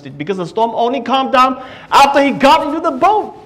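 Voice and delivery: fervent pulpit delivery; full-force preaching. Acted. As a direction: preach it out to the whole congregation.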